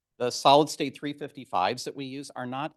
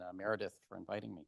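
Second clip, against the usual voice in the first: ordinary talk, nothing marked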